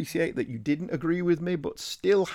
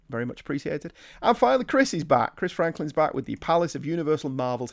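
normal talking voice

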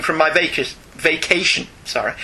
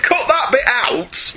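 drawn out slowly and higher; slow emphatic; 10 semitones higher, much slower than usual. Real, not acted. Host tells co-host to cut the bit, slow and pointed. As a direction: spell out the instruction slowly. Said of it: "You have my permission".

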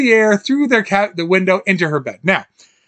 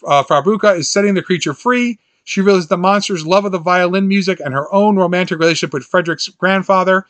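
ordinary talk

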